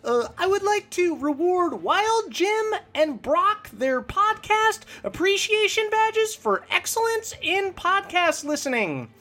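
high, far character voice for a scoutmaster; Scoutmaster Denny. Acted. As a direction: pitch way up into a cheery scoutmaster. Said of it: "people who requested Scoutmaster Denny, so here he is".

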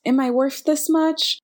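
high, rising, approval-seeking questions; unsure questioning. Acted. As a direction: voice an insecure inner question, pitched well up. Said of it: "this kind of underlying".

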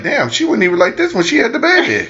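high, amazed exclamation; surprised. Retold or imitated. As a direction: blurt it out in disbelief. Husband retells his surprised thought.

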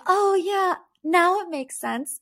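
high, airy mock "now it makes sense" with "now" lifted; mock realization. Mocking the cast finally getting the story. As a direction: play the ladies pretending it finally clicks. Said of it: "all the ladies were like".

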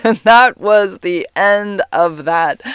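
laughing through the words; slow, laughing wrap-up. Real, not acted.

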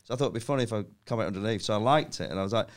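normal talking voice

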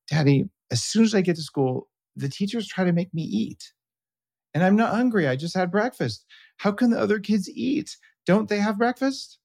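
put-on high little-girl voice; imitating young daughter. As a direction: play a puzzled kindergartner talking to daddy. Dad voicing young daughter's complaint.

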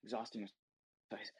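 echoed softly and slowly, sinking; fading echo. Shown as a demonstration. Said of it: "So he starts exhausting himself".

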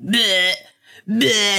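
mocking bleh noise. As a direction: copy the kid's silly taunt. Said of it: "making this stupid ass noise".

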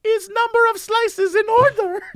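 high put-on voice of a helpless worker; poor little woman. Subway worker explains slices to customer, poor little woman. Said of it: "And she's like".

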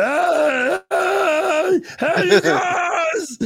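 high, loud drawn-out yell; Jared Reed impression. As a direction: call out like an excited friend. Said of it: "That was Jared Reed right there".

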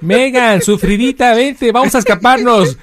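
high, playful put-on husband voice; mock pleading husband. Host playing Harry urging Meghan to escape, high mock pleading. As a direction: call her along in a high, laughing mock plea.

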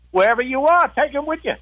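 agitated. Retold or imitated. Sergeant and Dugan argue.

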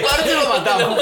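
high, giggling repeat; laughing mock panic. Friend laughing while repeating the panicked line, high. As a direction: squeal it through laughter.

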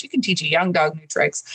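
usual speaking voice

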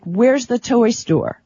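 normal talking voice

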